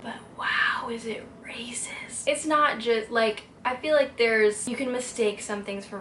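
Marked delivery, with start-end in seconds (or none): 0.0-2.3 hushed, slow whisper. Real, not acted. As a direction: breathe it out in disbelief. Shocked aside about racist book.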